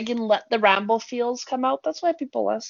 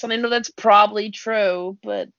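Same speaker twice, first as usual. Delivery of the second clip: ordinary delivery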